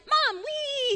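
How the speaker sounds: put-on high squeal of a kid sliding down; kid's high excited wee; child's gleeful cry. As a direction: call out like a thrilled toddler. Child on slide calling mom.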